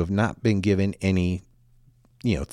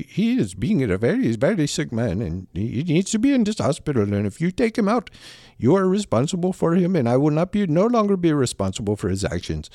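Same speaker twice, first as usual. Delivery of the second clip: badly imitated Indian accent, higher at times; terrible Indian accent; 5 semitones higher than usual. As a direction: mimic the objecting doctor in a clumsy Indian accent. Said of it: "I know that's a terrible accent".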